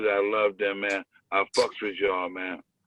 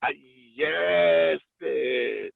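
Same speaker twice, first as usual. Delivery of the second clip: sassy yes bitch; 4 semitones higher, much slower than usual. Retold or imitated. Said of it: "it was like".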